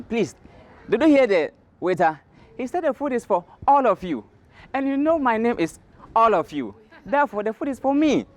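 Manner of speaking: high-pitched character voice making its case; sly tortoise's claim. Acted. Tortoise tells birds the food is his, high insistent voice.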